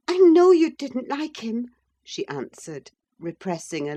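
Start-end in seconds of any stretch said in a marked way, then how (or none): 0.1-1.7 high, louder pleading wife; wife coaxing her cross husband; Catherine, eager young wife. Acted. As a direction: coax him, bright and high.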